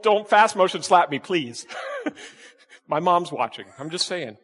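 ordinary delivery

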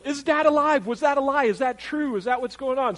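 high-pitched, excited string of questions; urgent questioning. Acted. Joseph asking his brothers about Dad, in urgent high questioning.

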